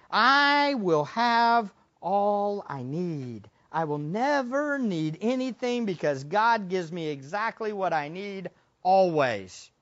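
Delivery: slow, soft, settled; calm quiet trust. Acted. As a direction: say it like a contented heart. Quoting trust softly.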